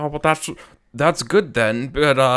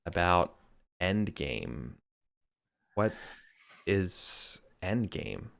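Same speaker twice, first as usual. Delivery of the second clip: slow, drawn-out speech; 6 semitones lower, 8 dB quieter than usual. Shown as a demonstration. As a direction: stretch every word out. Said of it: "just very slowly".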